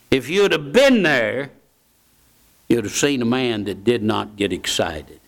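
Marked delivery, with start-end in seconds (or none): none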